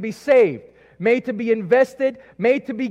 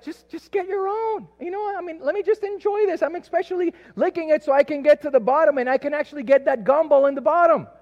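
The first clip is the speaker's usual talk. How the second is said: put-on whiny kid voice; 8 semitones higher than usual. Child pleading to keep ice cream.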